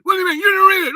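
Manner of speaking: very high, loud mock screech; losing his mind. Friend hearing it wasn't read, mock losing his mind. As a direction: explode in a hammed-up shrieking imitation. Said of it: "he, like, loses his mind".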